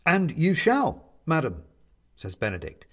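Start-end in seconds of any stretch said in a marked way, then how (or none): 0.1-1.6 high, loud, drawn-out cheerful promise; grandly cheerful, pitched up. A narrator's reading. Benedict promises the woman she shall go home, cheerfully. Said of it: "claps his hands together cheerfully".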